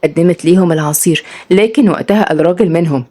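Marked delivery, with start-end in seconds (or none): none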